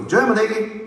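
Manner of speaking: high roll-call cry. Real, not acted. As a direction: lift the name up high for the crowd. Roll call of countries to crowd.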